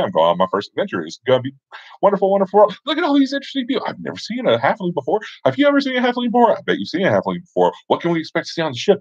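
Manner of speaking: high, fast, breathless rambling; chatterbox. Retold or imitated. New adventurer babbling to the ship's captain, high and fast. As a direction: gush it all out without stopping for air. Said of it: "I'm gonna make him a chatterbox".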